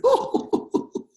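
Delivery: very high, loud string of oohs; crowd's delighted ooh. Retold or imitated. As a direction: imitate a whole crowd cooing with delight. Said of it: "and everybody went".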